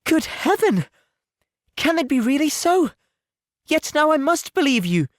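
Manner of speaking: overwhelmed, finally believing; Jane crying out. Acted. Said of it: "cried Jane".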